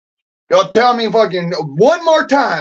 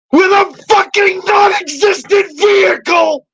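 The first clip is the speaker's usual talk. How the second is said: angry shout; 9 semitones higher, slower than usual. Real, not acted. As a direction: scream it out, voice cracking into a sob. Ranting about social security, breaking down.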